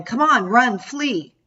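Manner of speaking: urgent mock yell; mother yelling to flee. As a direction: hurry her up, calling out.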